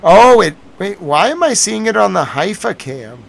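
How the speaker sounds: sudden loud rise in surprise; real surprise breaking out; startled. Real, not acted. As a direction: jolt as if caught off guard.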